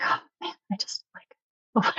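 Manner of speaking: hushed, exasperated mutter to oneself; frustrated. Retold or imitated. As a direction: retell private frustration under the breath. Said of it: "I would get really frustrated".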